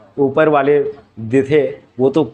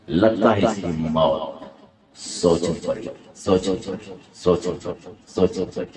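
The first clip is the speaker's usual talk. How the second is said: Danny; 8 semitones lower, 6 dB quieter than usual. Acted. Villain plotting the hero's death, low Danny impression. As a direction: repeat it low like a fading echo. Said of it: "हाँ, तो वो Danny villain के।".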